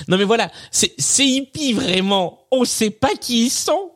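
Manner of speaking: put-on a cranky old grandpa, half laughing; very old man. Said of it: "C'est mieux avec la voix".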